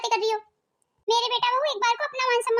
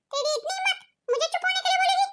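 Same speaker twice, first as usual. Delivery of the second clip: indignant outrage; 7 semitones higher than usual. Acted. Furious at being told shut up.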